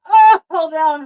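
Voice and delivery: loud, high flustered gasp; overwhelmed fan cry; excited cry. Real, not acted. Fan overwhelmed by Worf, crying out loudly.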